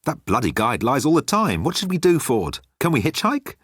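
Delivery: lightly voiced teenage girl; a hint of Random. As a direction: squint into the sun.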